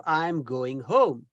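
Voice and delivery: slow, low, careful "I'm going home"; slow correct example. Shown as a demonstration. Teacher modelling the correct phrase slowly, low. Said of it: "instead of".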